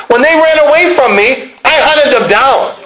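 high-pitched, driving intensity; enraged testimony. Acted. Paul confessing he hunted fleeing believers, enraged. Paul's enraged persecution, acted.

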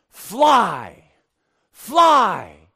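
barked "Fly, fly" commands, loud and high; drill sergeant. Retold or imitated. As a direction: yell it like a drill sergeant on the parade ground. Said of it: "a drill sergeant that says things like this".

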